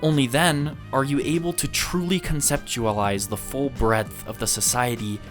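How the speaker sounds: ordinary talk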